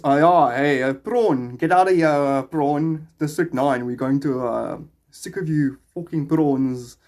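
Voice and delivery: drawn-out, put-on South African accent. Shown as a demonstration. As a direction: exaggerate a South African accent, stretching words. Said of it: "That's South African accent".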